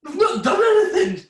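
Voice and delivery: incredulous laugh at the idle crew; laughing, can't believe it; laughing disbelief. Retold or imitated. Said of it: "I'm like".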